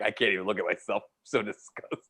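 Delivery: mock self-disgust. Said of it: "you'd be like".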